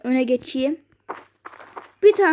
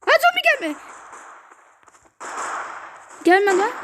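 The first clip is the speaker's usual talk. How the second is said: panicked; 7 semitones higher than usual. Real, not acted. Zombie approaching, panicked.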